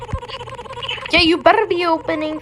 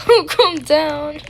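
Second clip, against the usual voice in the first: louder and drawn out, joking; loud, slow mock promise; 4 semitones higher, 4 dB louder, slower than usual. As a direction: belt the promise playfully.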